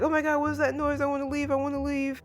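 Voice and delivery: high, fast freak-out; retold panic. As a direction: imitate panicking kids in one high rush. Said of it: "everybody freaks out except for Anya".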